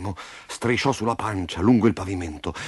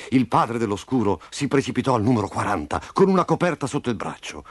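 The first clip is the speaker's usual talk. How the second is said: usual speaking voice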